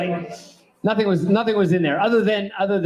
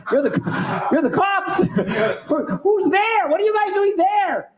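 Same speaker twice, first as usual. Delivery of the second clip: incredulous high mock; 10 semitones higher, slower than usual. Mocking the cops asking who's there.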